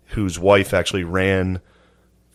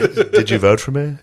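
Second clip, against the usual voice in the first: mock Trump question through laughter, higher; laughing; 6 semitones higher, 3 dB louder than usual. Imitating Trump asking the FBI head, laughing. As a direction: ask it while still laughing.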